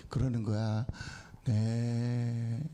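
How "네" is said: lightly voiced old man, low; a low, drawn-out old man's reply; a hint of weary old Abraham. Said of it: "아브라함이 많이 늙었어".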